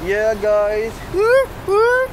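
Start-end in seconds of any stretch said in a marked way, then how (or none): 1.1-2.1 high excited woo; cheering. Real, not acted. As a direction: whoop it up high. Cheering into camera.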